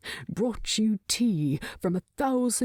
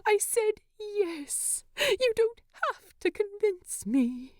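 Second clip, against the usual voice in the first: tearful laughing protest. Acted.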